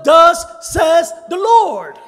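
slow, loud preacher's proclamation; proclaimed shout. Acted. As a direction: thunder it out slowly like a prophet. Preacher proclaiming prophetic quote.

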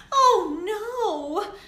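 high, loud wailing oh no; crying out. A narrator's reading. Lily wailing at waiting another month. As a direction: cry out in dismay like a child. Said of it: "cried Lily".

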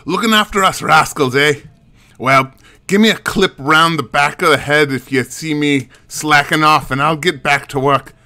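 lilting Scottish banter; Scottish accent. Acted. Monster hunter teasing the new security witch, Scottish accent. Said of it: "Scottish works? You're okay with the Scotch".

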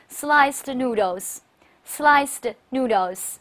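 sliced noodles said very slowly; very slow model reading. Shown as a demonstration. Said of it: "一块儿读一下". (极慢示范sliced noodles)

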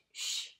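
"Shh" hushed, breath only; a long quiet shush; shh sound. Shown as a demonstration. Teacher modelling the sh sound for kids, hushed. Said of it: "or S H?".

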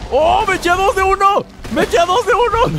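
very high, excited victory yell; elated boasting. Real, not acted. Player amazed at a double kill, elated boasting. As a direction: crow about it at a squeal-high pitch.

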